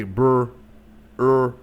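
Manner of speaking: "brr, er" with drawn-out r-coloured vowels; Midwest-style "brr, er", all on the r; Midwest dialect pronunciation. Shown as a demonstration. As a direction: demonstrate Midwest "brr, er" sounds. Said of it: "Midwest, like, they say".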